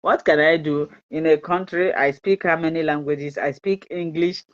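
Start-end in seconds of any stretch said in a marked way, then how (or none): none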